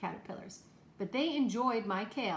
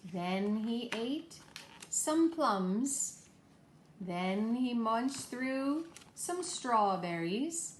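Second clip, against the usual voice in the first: slow storytelling emphasis; much slower than usual. Acted. Teacher recounting story foods to kids.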